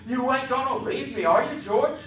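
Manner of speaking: put-on high childlike plea, rising; Lenny pleading. A narrator's reading. Lenny pleading with George, high and anxious. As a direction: beg him not to leave.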